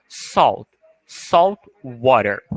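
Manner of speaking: "Salt" with an open O sound, the L barely heard; slow "salt water" with an open O in "salt". Shown as a demonstration. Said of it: "Com o som de O". ("salt water" devagar, com o som de O em "salt")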